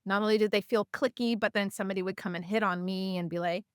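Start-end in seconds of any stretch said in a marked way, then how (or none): none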